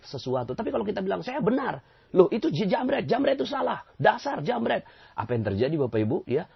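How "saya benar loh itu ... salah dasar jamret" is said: mock outraged, much higher and louder; indignant outburst. Preacher mimics someone cursing a snatch thief, indignant. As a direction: huff at the thief.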